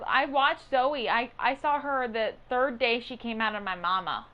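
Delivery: ordinary talk, nothing marked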